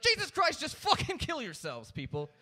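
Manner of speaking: loud, high exasperated blow-up; a comic yelling rant; exasperated outburst. Real, not acted. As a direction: throw up your hands and yell at them.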